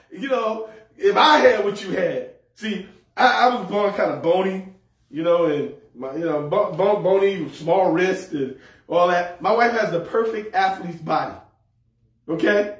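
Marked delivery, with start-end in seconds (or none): none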